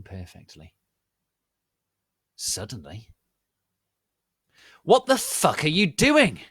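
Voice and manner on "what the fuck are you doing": high, loud outraged yell; shocked angry scream; furious shout. Acted. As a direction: bellow it at the intruders. Snape and McGoggle burst in.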